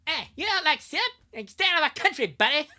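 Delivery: very high, loud cartoon Canadian; South Park Canadian. Telling a syrup hater to leave the country, South Park Canadian voice. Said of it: "This is a thing that South Park would do".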